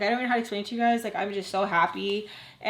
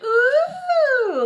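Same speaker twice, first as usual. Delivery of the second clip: high, loud, sustained teasing ooh; long mock crowd ooh, way up high; 8 dB louder than usual. Adults watching the flirting, teasing high ooh. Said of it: "like, the adults are like".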